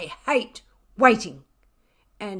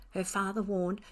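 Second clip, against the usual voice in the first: ordinary delivery, nothing marked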